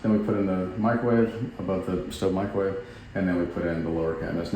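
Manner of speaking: ordinary delivery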